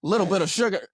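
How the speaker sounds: mock outrage, pitched high; incredulous. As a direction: act floored by the sugar order. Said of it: "And I'm like".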